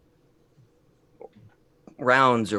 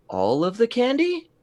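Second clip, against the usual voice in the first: all of the candy, pitched way up; high rising question; 12 semitones higher than usual. Acted. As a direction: ask it hopefully, voice rising. Greedy question about the candy.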